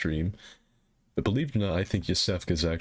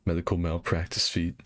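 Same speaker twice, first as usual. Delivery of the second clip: normal talking voice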